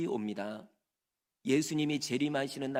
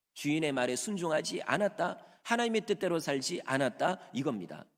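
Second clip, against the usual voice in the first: ordinary delivery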